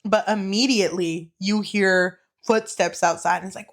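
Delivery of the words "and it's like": usual speaking voice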